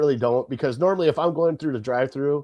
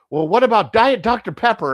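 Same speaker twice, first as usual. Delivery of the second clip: put-on higher, louder kid voice piping up; kid suggesting; 7 semitones higher, 5 dB louder than usual. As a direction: pipe up like a kid with a bright idea. Family member ordering from back seat.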